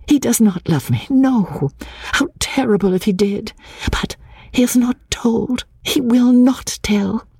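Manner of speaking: high, elated inner voice; longing to shout. A narrator's reading. Lucy reassuring herself he will not tell, high elation. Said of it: "She longed to shout the words".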